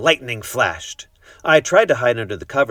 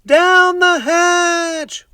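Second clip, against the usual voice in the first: very high, loud, very slow chorus; crew shouting; 9 dB louder, much slower than usual. A narrator's reading. The crew answering the toast, high drawn-out shout. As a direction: drag it out like a rowdy crew. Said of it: "we all shouted".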